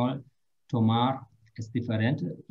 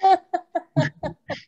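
bright high laugh; real laugh; 4 dB louder than usual. Real, not acted. Teacher laughing at a student's eagerness, real laugh.

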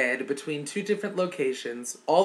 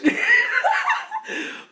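piercing very high laughter burst; shrieking laugh; 5 dB louder than usual. Real, not acted. Cracking up completely over the chitlin story. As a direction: squeal with uncontrollable laughter.

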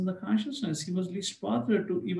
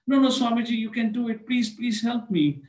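higher, louder begging; desperate pleading; 6 semitones higher, 4 dB louder than usual. Retold or imitated. Man begs Prabhupada to help him, desperate plea. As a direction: insist he can do it.

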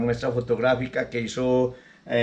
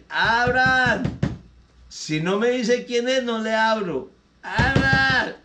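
put-on drunk demanding entry; 9 semitones higher, slower than usual. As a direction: bellow at the door like a drunk. Drunk at the door.

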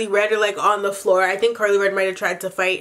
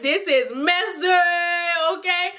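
slow drawn-out quip; 7 semitones higher, slower than usual. Retold or imitated. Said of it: "And I was like".

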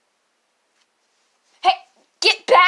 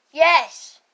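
ordinary talk

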